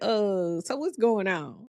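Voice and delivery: light teasing question; joking. Retold or imitated. Joking with mom at the bedside, a light tease. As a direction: keep the playful tease going. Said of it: "just joking with her".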